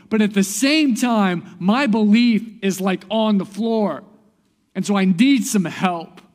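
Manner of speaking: imitating a father's loud, impassioned plea; crying out. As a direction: declare each belief high and loud, begging for help. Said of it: "the father of the child cried out and said".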